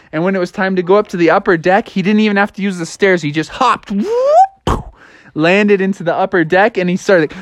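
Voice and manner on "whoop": high swooping leap noise; cartoon jump sound, very high; hop whoop. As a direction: whoop upward like a springing frog. Imitates the big hop.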